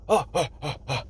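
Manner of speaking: string of short acted gasps; startled waking gasps. Crane operator jolted awake by worker, startled gasping.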